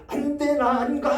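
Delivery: high-pitched imitated cry of refusal. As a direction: act out a dying person's frantic protest. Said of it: "막 이렇게 소리 지르면서". (겁에 질려 소리 지르는 흉내)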